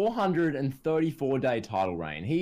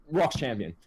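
ordinary talk, nothing marked

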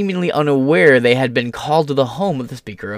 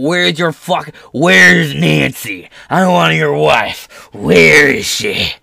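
mock intruder yelling; 3 dB louder, much slower than usual. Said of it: "They're screaming and calling out".

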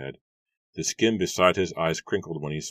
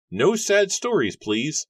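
much higher, louder, curt farmer's voice; brusque Matthew; 12 semitones higher, 6 dB louder, slower than usual. A narrator's reading. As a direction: cut him off as the gruff farmer. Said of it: "Matthew cut him off".

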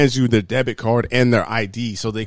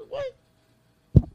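mock disbelief. Imagined reaction to being arrested.

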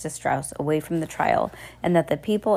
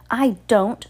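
raised-pitch exclamation; exclaiming; 5 semitones higher, 4 dB louder than usual. A narrator's reading. Sir objects to believing them, raised exclamation. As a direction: exclaim it, higher. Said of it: "Sir exclaimed".

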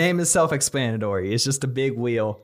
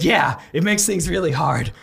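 higher, dramatic lament of a doomed soul; tragic monologue; 6 semitones higher than usual. Acted. Miserable popsicle bemoans its existence to the others, tragic monologue. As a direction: declaim it like a suffering Shakespearean hero. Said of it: "a monologue from Macbeth".

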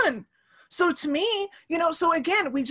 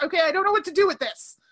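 higher-pitched exasperated mom; retold frustration; 5 semitones higher, 3 dB louder, faster than usual. Mom at a loss with crying daughter, exasperated. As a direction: give up, voice raised in pitch.

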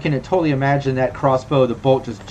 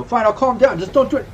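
high, flustered appeasing voice; acted panicky compliance, pitched up; squirming plea; 10 semitones higher than usual. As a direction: give in nervously while trying to wriggle free. Squirming character pleads with captors.

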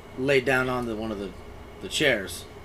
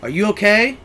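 raised voice, calling down; retold yell; 5 semitones higher, 6 dB louder than usual. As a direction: call it down to someone, mildly louder. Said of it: "yelled down".

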